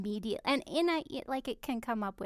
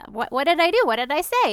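higher, louder, anxious confusion; bewildered panic; 5 semitones higher, 9 dB louder than usual. Retold or imitated. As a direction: blurt it out, lost and scared. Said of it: "I would just feel like".

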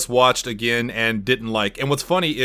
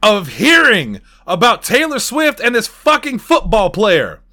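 exasperated rant; 8 semitones higher, 5 dB louder, slower than usual. Real, not acted. Ranting about [name].

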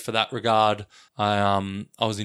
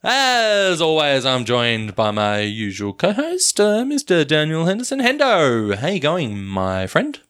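not speaking normally; 4 semitones higher, 5 dB louder than usual. Real, not acted. Host introducing his usual co-host, overblown intro voice. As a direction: stretch out the opening words, then ease down. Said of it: "forgotten how to speak normally".

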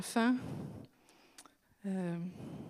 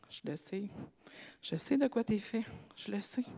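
soft, hushed voice of God consoling; God gently consoling a struggling believer; tender comfort; 8 dB quieter than usual. Pretend. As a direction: murmur it as a loving father.

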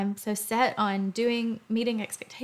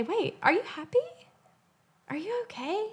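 concerned friend; 10 semitones higher than usual. Acted. Someone noticing you're different.

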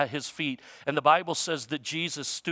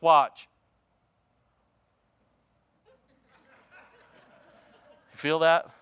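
a sharp "Watch." then a long held silence; pause demo; 5 dB louder than usual. Shown as a demonstration. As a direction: make them wait in the silence. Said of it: "Sometimes pausing makes everybody nervous".

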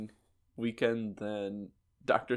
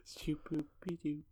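whisper; 4 semitones higher, 11 dB quieter than usual. Pretend. Scat ending whispered.

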